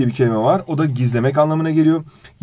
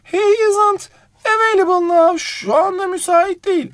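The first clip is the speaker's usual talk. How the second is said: much higher put-on female receptionist voice; woman on the phone; slower than usual. Receptionist answering a business phone call, high-pitched female voice. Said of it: "Kadına cevap veriyor".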